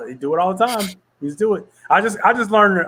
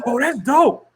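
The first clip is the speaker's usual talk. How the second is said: mock delighted producer; 9 semitones higher, much slower than usual. Imitating producer hearing own beat.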